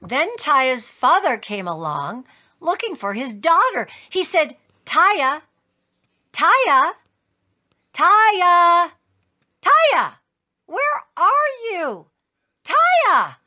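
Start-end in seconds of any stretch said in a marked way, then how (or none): 4.8-13.4 loud calls rising higher and higher; calling out. A narrator's reading. Father looking for his daughter, calling out. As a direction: call across the store, getting more urgent.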